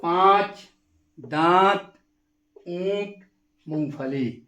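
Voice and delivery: पाँच, दांत, ऊंट, मूंगफली with the vowels sent through the nose; nasal vowels again. Shown as a demonstration. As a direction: repeat the words with clear nasal vowels. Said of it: "एक बार और".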